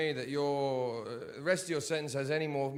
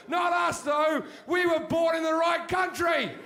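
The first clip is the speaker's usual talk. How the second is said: high, shouted caricature of a religious bigot; mock zealot rant; 10 semitones higher, 5 dB louder than usual. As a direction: rant like a frothing believer, much higher and louder. Mock zealot ranting about heaven.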